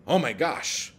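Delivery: high, loud startled gasp; startled character. Acted. Character in his example story jumping in fright, startled. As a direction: blurt it out in shock. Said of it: "And then he says".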